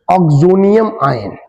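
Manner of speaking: "oxonium" drawn out slowly, then "आयन" falling; slow term. Shown as a demonstration. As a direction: lay out "oxonium आयन" slowly so students catch it. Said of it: "इसे बोलते हैं हम लोग".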